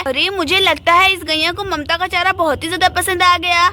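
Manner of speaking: usual speaking voice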